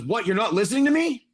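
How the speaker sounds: high, incredulous rising question; indignant question. Acted. Ghoul priest learns they are not following him, indignant. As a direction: act affronted at being ignored, pitch climbing.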